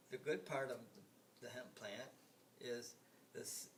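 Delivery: usual speaking voice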